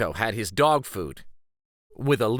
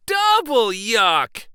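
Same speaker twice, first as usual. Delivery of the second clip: high, loud, slow drawn-out double yuck; exaggerated disgust; 10 semitones higher, 6 dB louder, slower than usual. Sophia answering Maddie about fish in backpack, mock disgusted. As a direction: stretch out the disgust, hamming it up.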